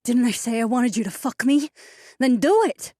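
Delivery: high, loud, impatient woman; demanding woman's voice. A narrator's reading. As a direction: demand it, losing patience. Narrator voices Alessia, demanding.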